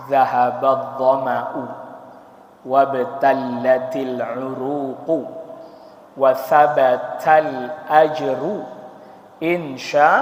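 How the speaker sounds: slow, measured recited prayer; hadith recitation. Shown as a demonstration. Preacher reciting the fast-breaking prayer to the congregation, slow recitation. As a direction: recite it word by word, very slowly. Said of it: "Hadis tentang doa apa?".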